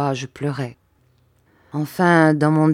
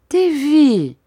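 high, louder pleading call of a mother; 10 semitones higher, 7 dB louder than usual. Acted. Mother begging her crying son, high pleading call. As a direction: call the child's name, begging him to turn round.

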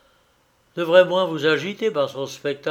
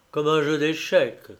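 plain reading voice

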